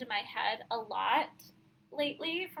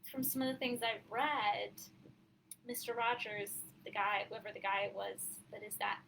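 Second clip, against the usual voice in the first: ordinary talk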